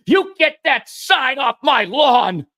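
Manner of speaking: high, slow, furious yell; a neighbor yelling to get the sign off her lawn; retold angry yell. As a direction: bellow it at the trespasser. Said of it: "She's pointing at me and yelling at me".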